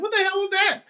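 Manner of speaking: shocked. Retold or imitated. Shocked outburst at the fight.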